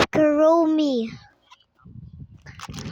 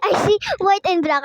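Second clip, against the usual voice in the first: excited; 6 semitones higher, 5 dB louder, much faster than usual. Real, not acted. Repeats excited discovery.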